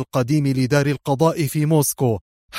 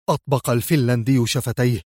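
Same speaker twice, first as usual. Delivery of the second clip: plain reading voice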